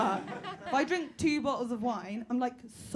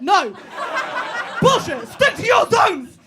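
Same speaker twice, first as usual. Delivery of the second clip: loud, high indignant shout; 9 semitones higher, 11 dB louder, slower than usual. Real, not acted. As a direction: bark the rule at them. Shouting at the audience.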